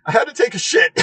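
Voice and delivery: high, loud blurt on the edge of laughter; amused mock confession. Retelling a friend's excuse for the missed flight, amused blurt. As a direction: blurt out the confession, about to crack up.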